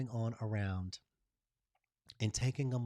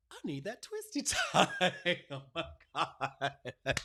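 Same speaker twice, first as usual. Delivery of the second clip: laughing; 5 semitones higher, slower than usual. Real, not acted. Laughs mid-quote at herself.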